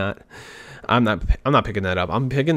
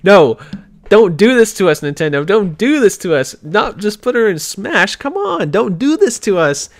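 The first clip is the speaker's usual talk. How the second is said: outraged pleading, building higher and louder; pissed-off fans; 6 semitones higher, 5 dB louder than usual. Retold or imitated. Voicing angry fans begging Nintendo not to do this, pissed off. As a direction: imitate a crowd protesting in mounting exasperation. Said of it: "all pissed at the same time".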